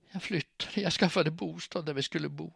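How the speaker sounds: usual speaking voice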